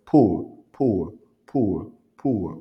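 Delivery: power with a forceful burst on P; vigorous consonant. Shown as a demonstration. Teacher drilling opposed consonants, power with vigorous P. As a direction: pop the P hard in each power. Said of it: "consonne toujours avec, euh, vigueur".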